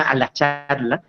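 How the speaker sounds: usual speaking voice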